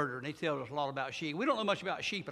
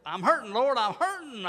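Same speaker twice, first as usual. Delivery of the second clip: louder, higher, whining I'm hurting; whiny complaint; 5 semitones higher, 7 dB louder than usual. Acted. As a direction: cry out like a hurt child.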